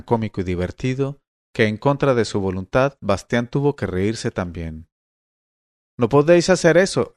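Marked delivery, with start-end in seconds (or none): 6.0-7.1 louder, higher cry of protest; exclaiming. A narrator's reading. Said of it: "exclamó".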